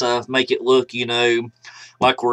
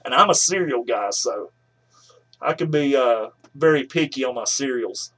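speaking through a vapor cloud; breathy with held smoke; exhaling vapor while talking. Real, not acted. Seen 1: Vape in hand, not at mouth; large vapor cloud exhaled while speaking.

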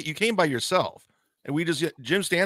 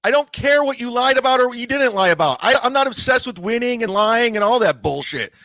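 angry; 8 semitones higher, 6 dB louder than usual. Real, not acted. Angry outburst at the caller.

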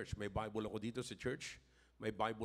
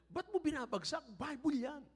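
much higher child voice, mock scolding; imitating a child; 14 semitones higher than usual. Grandfather imitating his grandson scolding him, high child voice. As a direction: play the pious little boy. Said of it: "Sabi niya".

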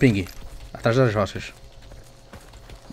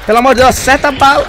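excited shout; 12 semitones higher, 11 dB louder than usual. Real, not acted. Pleading shout during firefight.

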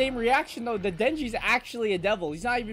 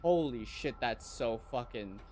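ordinary delivery

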